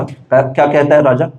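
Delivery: usual speaking voice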